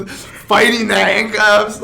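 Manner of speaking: slow words through laughter; laughing. Real, not acted. Laughing while retelling the scuffle.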